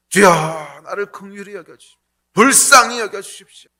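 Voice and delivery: 조금 높고 크게 애원하는 기도; 긍휼을 구하며 애원하듯; pleading prayer. Acted. Tax collector's plea voiced. (세리가 주님께 긍휼을 비는 애원)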